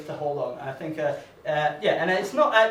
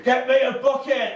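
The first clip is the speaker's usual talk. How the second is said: urgent plea; 6 semitones higher, 3 dB louder than usual. Retold or imitated. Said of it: "And she was like".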